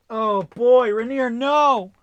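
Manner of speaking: high, loud wail of dismay; alarmed exclamation. Real, not acted. Game master warning Rainier off the Mind Flayer, loud dismay. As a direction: cry out as if warning a doomed friend.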